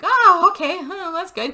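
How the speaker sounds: high, acted pleasant surprise; relieved surprise. Viewer retold learning Holt survives, pleasantly relieved. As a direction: start loud, then settle into relief.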